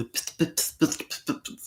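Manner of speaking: high clipped pit pit pit, fading to breath; imitating Mathieu Bock-Côté. Host mimicking a pundit's clipped rhythm in high pit sounds. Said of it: "c'est un peu comme, euh, Mathieu Bock-Côté".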